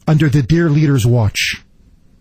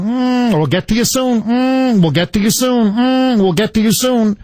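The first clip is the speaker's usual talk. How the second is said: mock brush-off; 4 semitones higher than usual. Host mimics VA phone brush-off.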